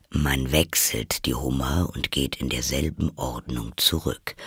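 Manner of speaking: low creature voice; deep-pitched Gryphon instructing; matter-of-fact Gryphon. Acted. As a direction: explain it in a low character voice. Gryphon in story.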